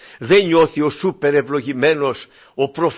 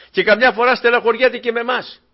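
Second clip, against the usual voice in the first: normal talking voice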